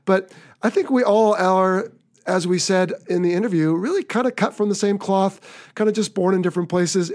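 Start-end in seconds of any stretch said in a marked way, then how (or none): none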